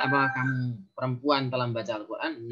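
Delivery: ordinary delivery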